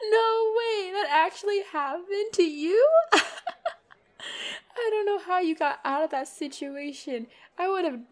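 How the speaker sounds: high, loud, talking through laughter; can barely get it out for laughing; cracking up at an embarrassing story; laughing in disbelief. Acted.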